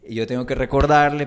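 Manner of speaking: normal talking voice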